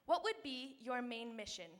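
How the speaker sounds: usual speaking voice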